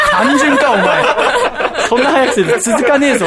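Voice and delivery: loud teasing rebuke; laughing scold. Real, not acted. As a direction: tease loudly through laughs. (笑いながら突っ込む)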